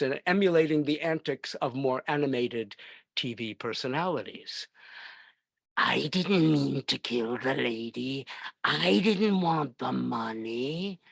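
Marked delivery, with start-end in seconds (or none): 5.8-11.0 slow, creepy foreign murmur, a bit pleading; Peter Lorre impression. Childhood fan doing a famous film villain, slow and pleading. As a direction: whine it like a guilty little crook in an old movie. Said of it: "what was it about Peter Lorre".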